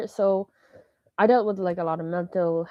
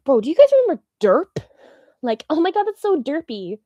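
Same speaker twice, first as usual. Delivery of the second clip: excited; 7 semitones higher, 6 dB louder than usual. Real, not acted. Excited nostalgic meme talk.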